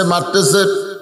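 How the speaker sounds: ordinary talk, nothing marked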